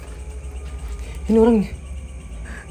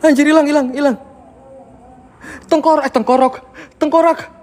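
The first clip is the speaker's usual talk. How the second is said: panicky; 6 semitones higher than usual. Real, not acted. Skull vanished, panicking.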